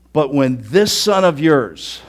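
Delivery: slow, loud and scornful; sarcastic contempt. Acted. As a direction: lean on this with disdain. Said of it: "sarcastically says with contempt".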